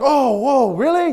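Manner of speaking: imitated wow of disbelief; amazed reaction. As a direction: act out a pleasantly stunned listener. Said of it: "Then they're like".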